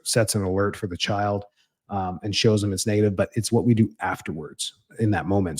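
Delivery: ordinary talk, nothing marked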